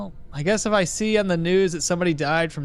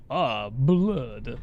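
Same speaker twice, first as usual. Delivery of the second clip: mock vampire accent, pitched lower; Dracula; 4 semitones lower than usual. Shown as a demonstration. Streamer testing a Dracula accent on chat, mock vampire voice. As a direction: try out a hammy vampire accent. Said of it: "how do you do a Dracula accent?".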